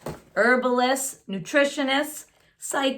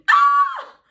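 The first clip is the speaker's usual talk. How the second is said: high piercing scream of fright; a startled therapist screaming in fright; 7 dB louder than usual. A narrator's reading. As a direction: shriek in sudden terror. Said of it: "The therapist screamed".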